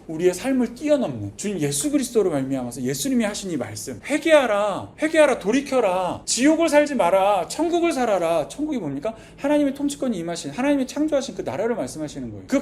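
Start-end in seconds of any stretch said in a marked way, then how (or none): 4.1-8.5 high, loud, slow proclamation; fervent preaching. Acted. 회중에게 외치는 설교자, 높고 큰 선포. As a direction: thunder it at the congregation.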